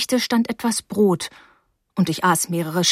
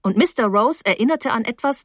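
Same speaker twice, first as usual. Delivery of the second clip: ordinary delivery, nothing marked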